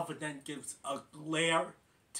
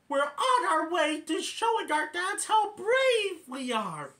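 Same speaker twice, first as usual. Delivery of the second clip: much higher and louder, proud; proud chipmunk chorus; 13 semitones higher, 8 dB louder than usual. Retold or imitated. Alvin and Bambi boasting about brave dads, high loud.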